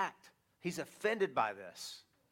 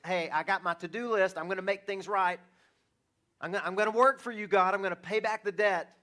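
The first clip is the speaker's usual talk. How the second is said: eager, faster, higher and louder mock promise to God; mock self-reliant pledge; 4 semitones higher, 6 dB louder than usual. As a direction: ham up a sinner bargaining with God. Mocking the self-reliant sinner.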